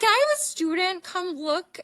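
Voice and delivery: bright cheerful gynecologist voice; chirpy doctor. Retold or imitated. Gynecologist cheerfully inviting a student in.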